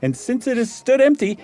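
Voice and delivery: high, animated character voice; host spinning a ghost tale; Meagle's new voice. Acted. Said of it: "It's really evolved".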